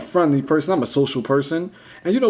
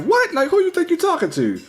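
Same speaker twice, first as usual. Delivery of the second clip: pitched way up, outraged at being brushed off; indignant disbelief; 14 semitones higher than usual. Retold or imitated. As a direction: ask it like you can't believe she said that. Indignant at tutor's rude reply.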